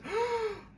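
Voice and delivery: sharp high gasp of surprise; imitating a startled doll gasping; shocked gasp. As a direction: gasp like you just saw a shotgun. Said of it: "I love that scene when he goes".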